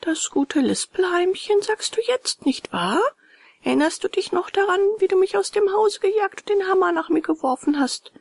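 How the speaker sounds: high, tiny scolding voice; high creature voice with reproach; reproachful little cricket. A narrator's reading. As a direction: remind him pointedly, pitched high. Cricket reproaches puppet boy.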